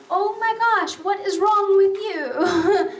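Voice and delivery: high, slow exasperated disbelief; appalled mother. Retold or imitated. Mom reacting to her daughter's answer, appalled.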